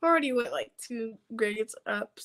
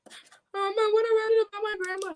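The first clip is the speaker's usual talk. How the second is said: high-pitched mock-crying whine; tearful whine; 11 semitones higher than usual. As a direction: plead like a kid about to cry. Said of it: "stop that crying".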